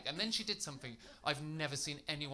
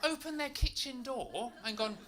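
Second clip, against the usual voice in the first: high, heated insistence; outraged denial to his fisherman friend; indignant protest; 11 semitones higher than usual. Retold or imitated.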